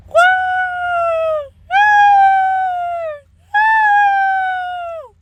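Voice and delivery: high, loud, drawn-out woo; ghostly howl. A narrator's reading. The noise starts again, a high long howl. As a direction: howl like a baying dog.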